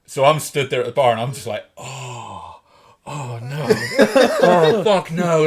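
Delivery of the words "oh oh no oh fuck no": hushed oh no swelling into a loud curse; slow, mounting horror at your own blunder; dawning dread. Retold or imitated. Dawning horror on hearing the news.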